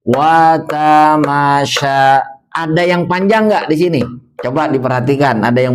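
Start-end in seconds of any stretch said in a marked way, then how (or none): 0.1-2.0 Watamasya read slowly, syllable by syllable; slow recitation. Shown as a demonstration. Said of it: "Mari baca terlebih dahulu". (membaca Watamasya pelan)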